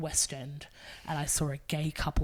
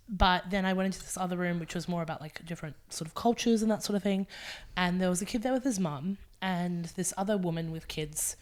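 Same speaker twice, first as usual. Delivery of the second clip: ordinary talk, nothing marked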